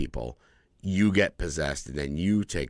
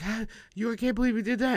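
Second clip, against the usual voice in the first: high, panicked cry of disbelief; panicked wife; faster than usual. Retold or imitated. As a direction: cry out in shock at the husband. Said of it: "She's like".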